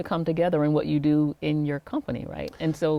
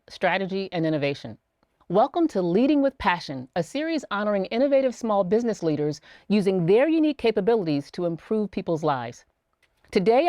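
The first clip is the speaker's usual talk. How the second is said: polished host intro, a little brighter than her chat; upbeat scripted welcome to the series; program intro read; 4 semitones higher, 3 dB louder than usual. Acted. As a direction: read the show opening like a presenter.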